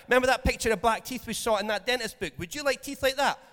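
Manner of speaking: stern dad warning. Retold or imitated. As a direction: warn the kid off Coke. Dad warning child about teeth.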